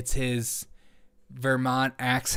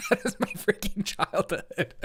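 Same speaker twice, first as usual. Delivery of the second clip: higher, slow, laughing through the words; 6 semitones higher than usual. Real, not acted. Host recognising her childhood food, laughing as she speaks. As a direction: say it while cracking up.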